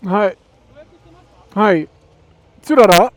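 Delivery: loud calls through a helmet; talking through a helmet. Real, not acted. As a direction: call out loudly, muffled by the helmet. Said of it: "talk to me with the helmet on".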